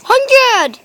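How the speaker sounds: high loud cry of a win; excited shout. Real, not acted. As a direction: call it out thrilled. Prize reveal.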